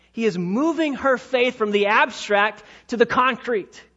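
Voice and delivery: ordinary talk, nothing marked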